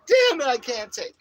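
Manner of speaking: loud, high mock breakdown; half-joking cry of being fed up; a hammed-up shout of being at the end of his rope; exasperated yell. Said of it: "but I'm like".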